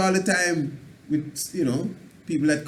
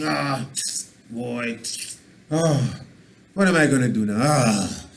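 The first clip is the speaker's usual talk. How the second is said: groaning, louder complainer voice, sagging with worry; moaning complainer; 2 semitones lower, 4 dB louder than usual. Shown as a demonstration. Complainer shows how people moan about life. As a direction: sigh out the woes like a beaten man. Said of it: "That's how people stay".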